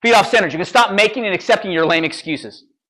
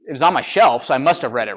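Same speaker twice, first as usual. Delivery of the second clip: ordinary talk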